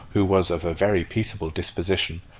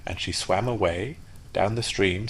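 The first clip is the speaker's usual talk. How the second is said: usual speaking voice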